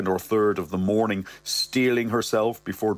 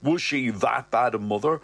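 ordinary delivery

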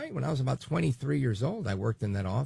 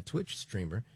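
normal talking voice

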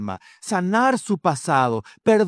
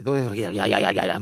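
low, rapid-fire mock German syllables; 8 semitones lower, faster than usual. Shown as a demonstration. Pastor showing how terrible German sounds, mock German. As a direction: lampoon German as a harsh string of sounds. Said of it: "así fuerte, ¿verdad?".

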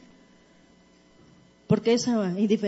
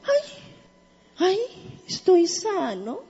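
a touch of amazed relief; lightly acted healed lame man; 6 semitones higher than usual. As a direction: gently act out discovering you are healed. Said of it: "que dijo el cojo?".

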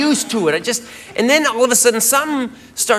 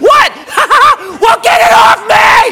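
much higher and louder, frantic through laughter; panicked high shout; 12 semitones higher, 7 dB louder than usual. Retold or imitated. Comedian begging girlfriend, high panicked shout. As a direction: yell in panic to get it off. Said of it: "And she goes".

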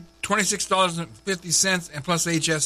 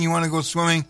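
usual speaking voice

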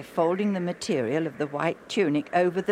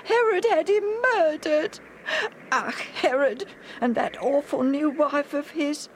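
put-on high, tearful boy's voice, breaking on "Herod"; a boy near tears over his murdered friend; sad; 12 semitones higher, 3 dB louder than usual. A narrator's reading. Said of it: "Alf said sadly".

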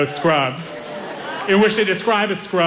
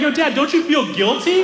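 teasing question; 11 semitones higher than usual. Retold or imitated. Teasing his dad.

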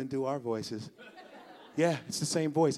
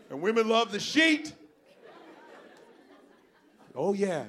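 ordinary talk

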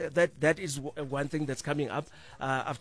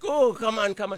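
higher, louder coaxing; exasperated pleading; 7 semitones higher, 5 dB louder than usual. Retold or imitated. Parent coaxing a stubborn child, exasperated pleading. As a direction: urge them on, half in play. Urging reluctant child to drink.

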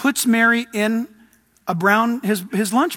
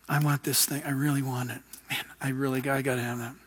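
much softer, breathy longing for the thing; softly pleading inner voice; hushed craving; 7 semitones lower, 11 dB quieter, faster than usual. Retold or imitated. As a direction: murmur it like a secret want. Craving voice of a wanting believer.

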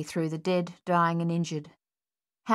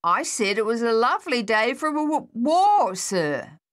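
higher, louder, WAR punched; louder repeat; 6 semitones higher, 5 dB louder than usual. Acted. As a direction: repeat it firmly, hitting war. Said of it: "I heard you the first time".

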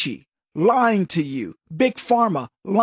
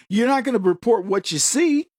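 ordinary talk

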